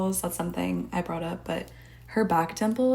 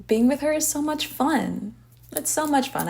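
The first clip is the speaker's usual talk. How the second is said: gushing; 7 semitones higher, 4 dB louder than usual. Real, not acted. Gushing about the character.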